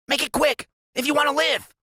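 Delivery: high, urgent genius voice; Freak's high voice. A narrator's reading. Sidekick warning his friend of the closing gang. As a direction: rattle off the danger in a high voice.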